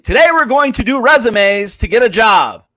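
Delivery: mock classroom announcement, projected; high school teacher. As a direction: imitate a teacher addressing the whole class. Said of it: "high school economics. They're like".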